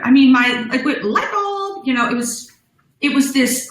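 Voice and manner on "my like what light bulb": ordinary delivery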